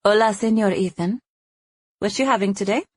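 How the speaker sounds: put-on waitress greeting with a Mexican accent; authentic Mexican accent. A narrator's reading. Waitress takes a regular's order, Mexican accent. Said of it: "in a real, authentic Mexican accent".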